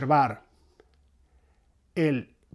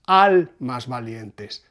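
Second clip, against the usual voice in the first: Almas valientes, slow, accents on al and lien; stress on Almas valientes; 2 semitones lower, 3 dB louder, much slower than usual. Shown as a demonstration. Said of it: "siempre se acentúa la primera". («Almas valientes», lento, marcando al y lien)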